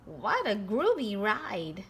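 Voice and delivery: usual speaking voice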